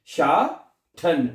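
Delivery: शाठन slowly, in two separated syllables; T as aspirated TH. Shown as a demonstration. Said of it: "टी को ठे पढ़ना है".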